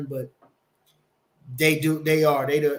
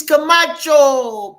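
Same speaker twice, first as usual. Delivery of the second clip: playful drawn-out call; 9 semitones higher, 9 dB louder, much slower than usual. Acted. Calling out a chatter's name.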